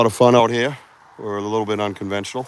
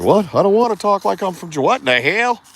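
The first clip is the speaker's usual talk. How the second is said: high, put-upon mock complaint; mock whiny protest; 10 semitones higher, 4 dB louder than usual. Griping about picking up the Georgia accent, high mock whine. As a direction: squeak it out like a reluctant convert. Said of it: "It's like".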